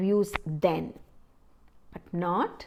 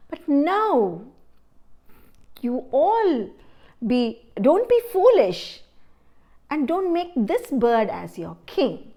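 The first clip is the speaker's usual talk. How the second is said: urgent plea; 5 semitones higher, 4 dB louder than usual. Acted. Crow urges the birds.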